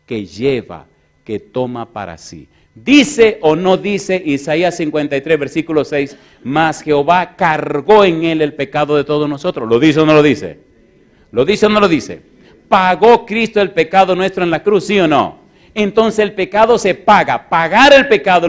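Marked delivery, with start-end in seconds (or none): 2.7-9.7 loud, high-pitched pulpit preaching; forceful preaching. Acted. 9.7-10.6 loud demanding question to the congregation; challenging the crowd. Acted. 11.3-12.2 same challenge pitched higher and loud; pressing harder. Acted. 12.7-18.5 loud, high-pitched pulpit preaching; forceful preaching. Acted.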